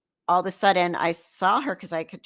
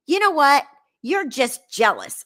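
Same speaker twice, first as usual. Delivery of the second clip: higher, punchy mock accusation; retold accusation; 6 semitones higher, 4 dB louder than usual. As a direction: imitate a friend snapping back in a quarrel. Friend's accusation in retold quarrel.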